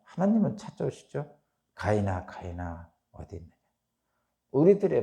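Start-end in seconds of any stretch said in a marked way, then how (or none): 1.7-3.5 softer, fading call into the dark; God softly calling the hiding Cain, fading away; gentle calling. Acted. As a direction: call for him tenderly, softer each time.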